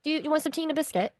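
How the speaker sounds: light British accent, fast; a cheery British hostess offering tea. Shown as a demonstration. Guest demos a light British accent. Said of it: "I could go British".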